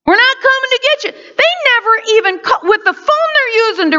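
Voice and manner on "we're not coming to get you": mocking taunt. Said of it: "they're mocking him".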